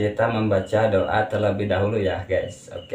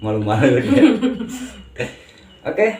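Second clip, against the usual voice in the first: laughing; 7 semitones higher than usual. Real, not acted. Teasing his shy wife.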